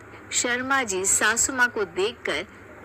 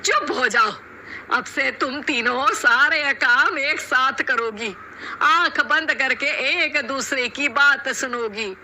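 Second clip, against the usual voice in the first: lightly raised commanding voice; stern mother-in-law; 4 semitones higher, 3 dB louder than usual. Acted. Mother-in-law ordering the quarrelling bahus, firm and stern. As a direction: set the rules firmly.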